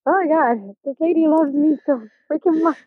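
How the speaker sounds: overjoyed, half laughing at being loved; delighted gush. Retold or imitated. Teen realizing her host mom loves her, giddy. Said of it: "And I'm like".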